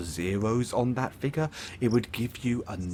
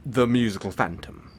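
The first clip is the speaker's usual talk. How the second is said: insistent repeat; 3 dB louder than usual. Acted. Villain repeating the name for the listener, insistent. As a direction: repeat the title firmly, letting it fall.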